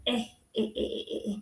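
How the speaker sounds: breathy giggling laugh. Real, not acted. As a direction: giggle softly to yourself. Laughing at memory.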